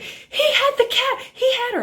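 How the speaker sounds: very high-pitched mock neighbor; imitated screaming. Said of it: "she is screaming at Kevin".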